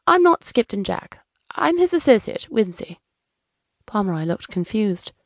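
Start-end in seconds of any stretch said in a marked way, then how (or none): 0.1-3.0 high, light voice setting him straight; chirpy correction. Acted. Associate correcting the client's mistake, high and chirpy.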